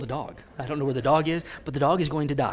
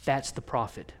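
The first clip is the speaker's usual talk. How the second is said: normal talking voice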